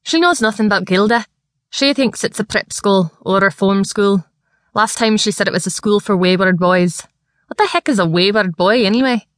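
Scottish lad grumbling about his sister; broad Scottish accent. Acted. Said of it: "His thick Scottish accent".

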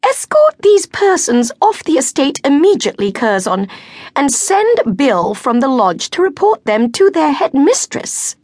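high, loud icy order; haughty countess ordering the servant; cutting command. A narrator's reading. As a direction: cut across them with authority. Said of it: "The Countess's voice cut across".

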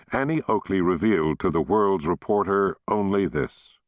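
ordinary delivery, nothing marked

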